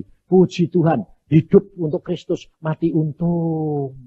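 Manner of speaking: defiant praise to the devil; slow proclamation. Acted. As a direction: proclaim it triumphantly. Proclaiming faith against devil.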